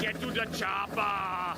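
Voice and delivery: action hero voice. Acted. Quoting an action movie line.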